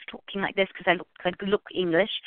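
loose English accent demo; casually put-on English accent. Shown as a demonstration. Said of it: "the English accent".

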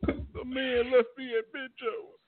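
high, slow mocking whine; mock crying friend. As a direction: mimic your buddy whining, high and drawn out. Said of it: "and you was like".

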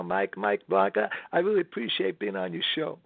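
raspy crooner's talking voice. Shown as a demonstration. Singer thanks radio hosts, lightly raspy crooner impression. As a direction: speak appreciatively with a little rasp. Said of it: "he talks a little bit like this".

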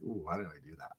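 low, soft, groaning regret; low, soft queasy moan of regret; regretful groan voice. Retold or imitated. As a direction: moan it like a full stomach aching. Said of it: "I say it in that voice too".

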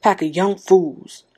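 put-on slow, gruff old-timer's scorn; slow stubborn old man; stout old man. A narrator's reading. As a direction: grumble it like a stubborn old farmer. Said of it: "Old Man Warner said stoutly".

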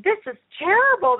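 high-pitched, exaggerated disapproval; hammed-up naysayer; mock doubtful critic. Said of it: "doubtful voice at work and someone's".

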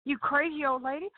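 high, offended man's voice; playing the outraged friend; mock indignant man. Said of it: "he says".

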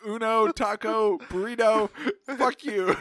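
loud, slow, high fake Spanish; hammed-up loud mock Spanish; mock defiant. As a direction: shout the words out one by one. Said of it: "I'd be like".

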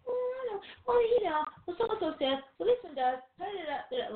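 high-pitched dismissive imitation; put-on high voice rattling off excuses; mock excuse-making. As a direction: mimic someone brushing off advice, up high. Mimics people rationalizing away godly wisdom.

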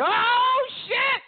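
high, loud hammed-up outburst; cartoonish surprised yelp; mock excited shout. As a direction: imitate a friend losing it over a movie. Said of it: "he'll say".

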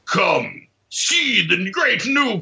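high, slow hammy movie trailer voice; trailer announcer. Pretend.

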